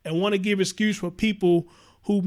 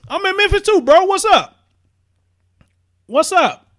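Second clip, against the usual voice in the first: loud, high, hyped greeting to a viewer; louder and higher, like greeting a hometown friend; excited shout-out; 5 semitones higher, 8 dB louder than usual. Real, not acted. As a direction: call out to a fan in chat with big energy.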